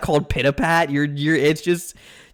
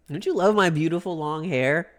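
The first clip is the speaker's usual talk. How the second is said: ordinary delivery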